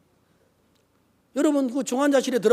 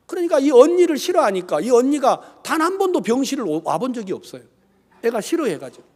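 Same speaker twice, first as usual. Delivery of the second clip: ordinary talk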